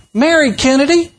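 high, acted name reveal; mock innocent answer. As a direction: answer high, playing the unsuspecting classmate. Acted answer, punchline building.

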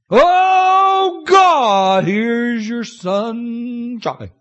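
shouted, high-pitched "Oh, God", then a slow drawn-out "here's your son"; mock excited prayer. Pastor mocking what people want from his prayers, an overexcited shout. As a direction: ham up a fired-up preacher's prayer. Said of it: "we want him to get all excited".

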